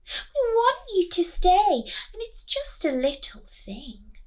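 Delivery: high, loud, pleading other mother; high sugary other mother, pushing hard. Acted.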